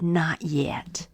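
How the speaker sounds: soft low whispered not yet; gentle whisper. Acted. Potter gently answering the teacup, whispered. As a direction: whisper it kindly, unhurried. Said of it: "gently said".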